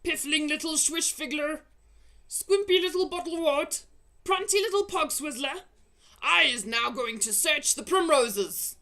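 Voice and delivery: loud, high jeering shout; insulting shout. Acted. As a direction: hurl the insult. Giant hurling insults.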